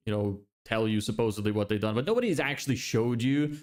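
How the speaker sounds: usual speaking voice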